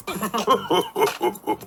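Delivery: light giggle. Acted. Children giggling at Daddy Pig, high light laugh.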